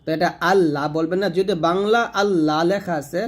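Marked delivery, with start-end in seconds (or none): none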